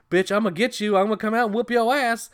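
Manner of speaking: loud, high-pitched mock threat; angry threat. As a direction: holler it like a hammed-up threat. Acted threat from outhouse.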